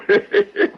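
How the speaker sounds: a sudden high cackle; high cackling laugh. Acted. Tavern keeper laughing at his own joke, a high cackle.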